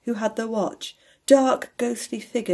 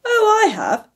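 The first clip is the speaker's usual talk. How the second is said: protesting; 13 semitones higher, 6 dB louder than usual. A narrator's reading. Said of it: "said Scrooge's nephew".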